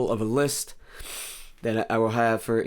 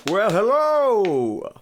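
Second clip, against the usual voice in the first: high, loud, drawn-out greeting; hammed-up high hello; 9 semitones higher, 7 dB louder, much slower than usual. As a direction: stretch it out in a silly high voice. Silly greeting after drinking water.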